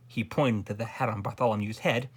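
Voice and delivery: ordinary delivery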